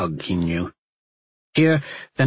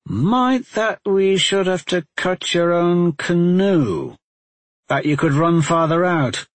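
sly; 7 semitones higher than usual. Acted. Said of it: "Talmadge said slyly".

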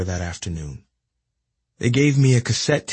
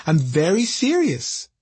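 high, slow smiling reply; 8 semitones higher than usual. Retold or imitated. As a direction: say it with a smile in your voice. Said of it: "with a smile in his voice".

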